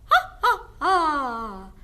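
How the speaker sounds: high, loud cackling witch laugh; witch cackle. Shown as a demonstration. Teacher shows the class a witch's cackle. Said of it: "witches laugh".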